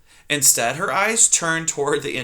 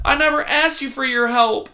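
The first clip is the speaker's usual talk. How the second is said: exasperated; 11 semitones higher, 3 dB louder than usual. Acted. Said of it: "an exasperated Poppy replied".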